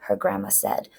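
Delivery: ordinary delivery, nothing marked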